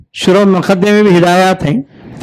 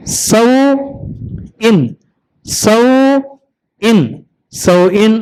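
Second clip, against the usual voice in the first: slow drawn-out demo; much slower than usual. Shown as a demonstration. Teacher modelling सौइन slowly for a pupil. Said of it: "आ दन, आदन".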